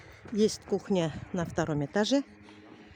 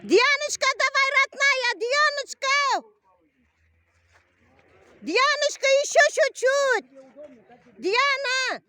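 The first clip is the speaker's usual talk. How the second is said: very high, loud cheering; 4 dB louder than usual. Real, not acted. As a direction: yell encouragement at a racer. Mother cheering daughter in ski race.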